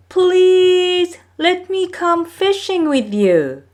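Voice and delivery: high, pleading little-brother voice; young Maui begging. Acted. Young Maui begs his older brothers, high and pleading. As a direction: beg to be taken along. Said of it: "Maui begged his older brothers".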